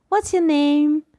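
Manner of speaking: put-on high cooing question to a baby; baby talk. Shown as a demonstration. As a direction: ask it like cooing at a newborn. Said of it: "We talk to it".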